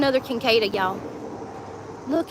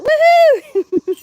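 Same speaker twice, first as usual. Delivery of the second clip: much higher, louder whoop; woohoo cheer; 13 semitones higher, 8 dB louder than usual. Real, not acted. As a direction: celebrate the win. Celebrating found cup.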